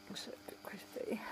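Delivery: whisper. Real, not acted. Whispered aside about hair.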